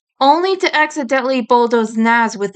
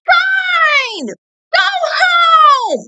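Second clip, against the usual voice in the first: furious high scream at his friend; 4 dB louder, much slower than usual. Acted. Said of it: "Eddie screamed".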